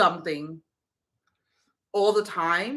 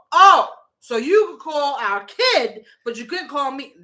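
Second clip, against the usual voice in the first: sarcastic retort; 8 semitones higher, 9 dB louder than usual. Retold or imitated. Wife retorts to husband's excuse.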